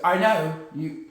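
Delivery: put-on low, clumsy dubious accent; reply low in a muddled accent; bad Forrest Gump-like accent; odd put-on accent. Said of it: "What's that accent?".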